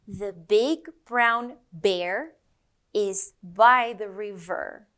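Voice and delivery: "The big brown bear is by the river" slow with each B popped; slow teaching sentence. Shown as a demonstration. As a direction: say it slowly, popping every B. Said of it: "Repeat after me".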